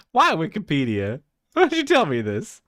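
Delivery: high, loud wail through laughter; laughing, squealed complaint; mock dismay. Real, not acted. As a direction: cry out in comic horror. Mock dismay at a gross fact.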